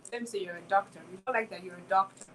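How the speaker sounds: normal talking voice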